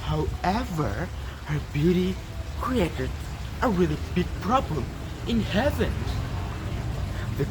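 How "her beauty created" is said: usual speaking voice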